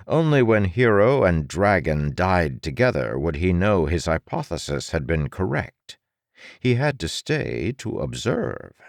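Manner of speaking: ordinary delivery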